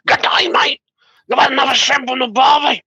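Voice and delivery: high, hammed-up Aussie caricature; extreme Australian accent. Shown as a demonstration. Co-host demonstrates the extreme kind, over-the-top Australian accent. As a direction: lay the Australian accent on as thick as it goes. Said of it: "then there's the extreme Stra-, Australian accent".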